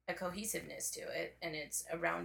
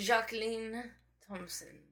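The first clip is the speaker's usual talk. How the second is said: Jacqueline said zhah-KLEEN in French accent; 4 semitones higher, slower than usual. Shown as a demonstration. Artist saying her name in French for the host, French accent. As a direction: give Jacqueline the French sound. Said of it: "Say your name in French".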